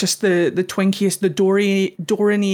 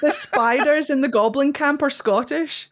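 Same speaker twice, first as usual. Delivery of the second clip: normal talking voice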